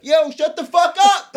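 loud, high, drawn-out holler; imitated angry shout from afar; neighbor yelling. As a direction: yell across the street at a noisemaker. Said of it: "someone go".

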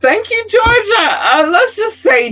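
very high-pitched, loud thanks; excited high shout. Real, not acted. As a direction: squeal your gratitude out to the crowd.

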